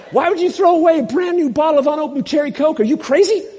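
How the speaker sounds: a playful, hammed-up take on an indignant comedian; Chris Rock impression. Pastor imitating C J's outrage over the cherry Coke, high fast rant. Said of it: "I'm gonna try and imitate C J's Chris Rock voice".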